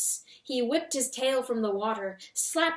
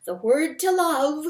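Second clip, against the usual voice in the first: panting; 6 semitones higher, 8 dB louder than usual. A narrator's reading.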